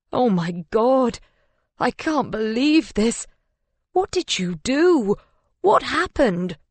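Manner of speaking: high, distraught disbelief; mounting horror, pitch climbing into the questions; horrified. A narrator's reading. Husband demanding to know how his wife died, horrified. Man reels at the death.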